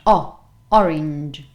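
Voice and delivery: O alone, then orange stressed on OR; slow O, then OR-inj with a punched start; strong first syllable. Shown as a demonstration. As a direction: isolate the O, then hit it in orange. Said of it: "this is a strong syllable".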